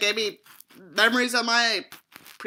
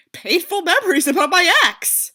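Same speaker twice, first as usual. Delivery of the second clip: sarcastic outburst; 8 semitones higher, 8 dB louder than usual. Real, not acted. Sarcastic reaction to backstory.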